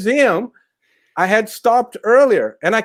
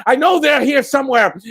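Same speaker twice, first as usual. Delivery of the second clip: higher, louder call to be ready; guard's warning shout; 5 semitones higher, 4 dB louder than usual. Acted. Driver warns the guard, loud and higher. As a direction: warn them like a lookout. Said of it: "and said".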